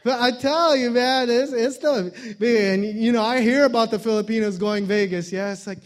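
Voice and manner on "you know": ordinary delivery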